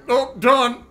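playful Newfoundland impression; Newfie accent. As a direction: toss it off as the Newfie. Acts out the Newfie man.

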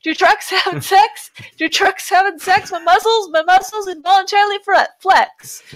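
deadpan spoken reading of a silly song; solemn lyric recital with a straight face; recited song lyrics. Pretend. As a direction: read the lyrics straight-faced like a poem.

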